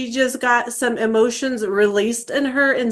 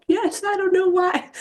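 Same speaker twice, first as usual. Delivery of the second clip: imitating daughter; 10 semitones higher than usual. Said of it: "And she's like".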